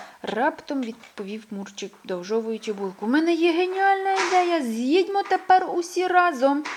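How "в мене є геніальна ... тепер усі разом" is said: much higher and louder, bursting with the idea; a mole announcing his genius idea to everyone; excited idea. A narrator's reading. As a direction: pitch the plan with glee. Excited proposal, far voice.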